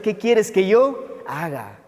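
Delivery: slow question, stretching "yo" before "haga"; earnest question. Acted. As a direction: hold "yo", then land "haga". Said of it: "Y ahora le pregunta al Señor". (pregunta lenta, recalcando "yo haga")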